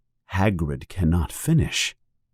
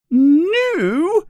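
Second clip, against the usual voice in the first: much higher, shrieked and louder; Aunt Petunia yelling; 4 dB louder than usual. A narrator's reading. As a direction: shriek it in outrage. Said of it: "yells Aunt Petunia".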